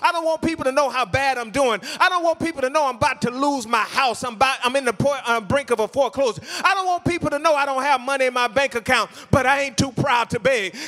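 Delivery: impassioned pleading, high-pitched and insistent; fervent preaching. Acted. Preacher voicing a struggling believer's plea to God, fervent preaching. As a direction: pile up each plea in a driving preacher's cadence.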